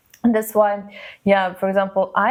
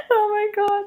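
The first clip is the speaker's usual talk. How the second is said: high, breathless through laughter; laugh-crying; 13 semitones higher than usual. Real, not acted. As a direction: gasp it out mid-laugh. Laughing hard at the song.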